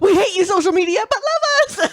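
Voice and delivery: high, loud mocking voice; mock social media user. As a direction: play a needy online star, loudly. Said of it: "It's like".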